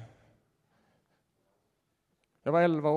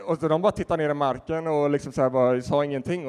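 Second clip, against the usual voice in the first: ordinary talk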